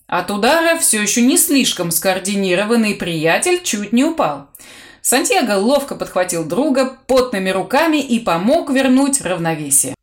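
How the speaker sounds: usual speaking voice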